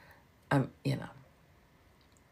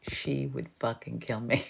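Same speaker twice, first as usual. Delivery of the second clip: laughing hard; 8 dB quieter than usual. Real, not acted. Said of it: "I'm laughing so hard".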